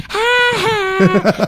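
high, two-note bully jeer; Nelson's mocking laugh. Imitating the Simpsons bully, mocking high laugh. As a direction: taunt with a sing-song mock laugh. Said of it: "the bully on Nelson from The Simpsons".

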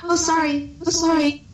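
high-pitched, quick-reflex mock apology; reflexive apology. Acting out reflexive doorway apology.

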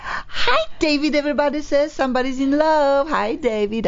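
high-pitched on-air shout-out; excited greeting. Real, not acted. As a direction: greet a listener with a high, delighted call. Calling out to guest's husband on air.

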